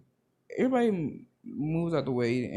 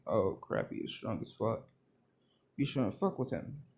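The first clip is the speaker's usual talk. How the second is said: hushed warning; 4 semitones lower, 6 dB quieter than usual. Retold or imitated. Onlookers warning about strong Bakugo.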